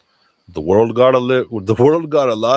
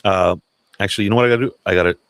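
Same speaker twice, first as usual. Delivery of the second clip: ordinary talk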